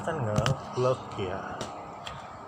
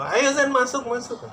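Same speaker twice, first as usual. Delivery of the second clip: much higher, calling voice; 11 semitones higher than usual. Retold or imitated. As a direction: call him in from outside, ending in a laugh. Friend calling him into studio. (memanggil dengan suara tinggi)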